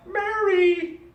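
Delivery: high, drawn-out, loud happy cry. Shown as a demonstration. Teacher shows student her name said very happy, overjoyed call. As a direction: sing out the name with exaggerated joy. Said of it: "as in very happy".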